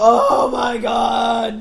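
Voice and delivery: loud, long drawn-out mock wail; retold exasperated groan. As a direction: stretch it out in theatrical exasperation. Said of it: "And so I go".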